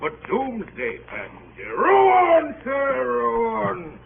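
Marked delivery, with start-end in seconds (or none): 1.8-4.0 loud shout over the water; calling out. Acted. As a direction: bellow it across to the boat. Sailors rowing, calling out.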